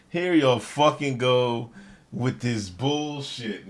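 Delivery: drawn-out, weary repeat; slow, exasperated repeat; slow exasperation. Real, not acted. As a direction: stretch it out in disbelief. Repeat slowly.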